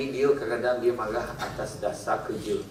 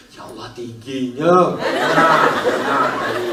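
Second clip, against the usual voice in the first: laughing exclamation; 6 dB louder, slower than usual. Real, not acted. Laughing at the tall one.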